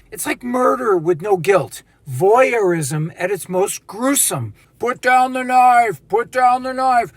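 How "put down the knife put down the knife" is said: cop giving orders. Retold or imitated. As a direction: order him to drop it like a cop. Cops ordering a knife holder.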